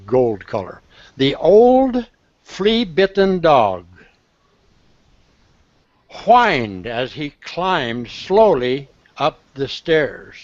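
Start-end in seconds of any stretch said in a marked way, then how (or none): 1.2-10.4 read-aloud sentence. Shown as a demonstration. Teacher reading the example to the class, slowly and expressively. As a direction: read the sentence out slowly, savouring each word. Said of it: "Look in the, in the gold color".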